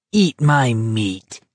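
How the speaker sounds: slow, drawn-out snarl; understated slow snarl. A narrator's reading. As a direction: snarl it slowly, word by word. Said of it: "Barkovich snarled".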